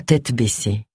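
ordinary delivery, nothing marked

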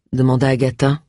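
plain reading voice

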